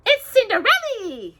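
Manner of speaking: very high squeaky mouse cry; excited mouse squeak. Acted. Mouse Gus bursting with news, high excited squeak. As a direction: squeak it out like a thrilled little mouse. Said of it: "Gus squeaked excitingly".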